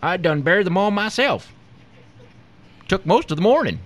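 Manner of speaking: high-pitched country farmer; a rustic yokel, pitched well up; unbothered farmer. A narrator's reading. Farmer reporting the burials to agent, unbothered. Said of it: "the farmer sighed".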